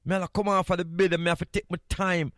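ordinary delivery

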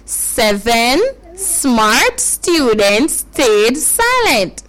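very slow, spaced-out words; careful demonstration. Shown as a demonstration. Teacher repeats for children to follow, very slow and careful. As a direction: draw out each word for learners. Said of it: "listen carefully, listen carefully".

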